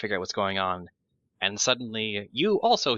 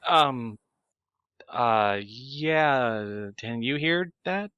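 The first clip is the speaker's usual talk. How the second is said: hesitant surprise; 4 semitones higher, 4 dB louder, much slower than usual. Acted. Character startled that thoughts are overheard.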